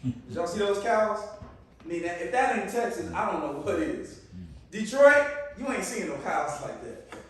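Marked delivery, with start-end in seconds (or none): none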